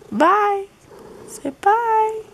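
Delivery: high cooing pet voice. Real, not acted. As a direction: coo at the cat, very high. Baby talk to cat.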